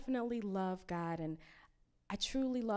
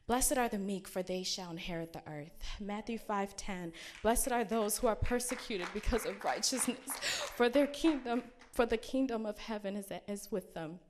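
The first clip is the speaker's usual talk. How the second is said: fervent scripture reading; 2 semitones higher, 6 dB louder than usual. Acted. Reads Beatitudes with fervor.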